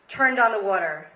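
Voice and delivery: normal talking voice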